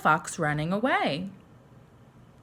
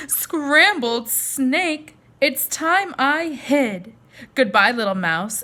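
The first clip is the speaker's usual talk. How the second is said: very slow, higher, louder hissing snake; startled snake; 6 semitones higher, 5 dB louder, slower than usual. Acted. Frightened snake sliding off from the mouse, slow and high. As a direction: stretch out the words in alarm like a snake.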